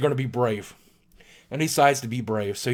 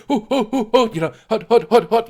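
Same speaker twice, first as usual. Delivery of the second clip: swat team chant; 7 semitones higher, 4 dB louder than usual. Retold or imitated. Mimicking swat team rappelling.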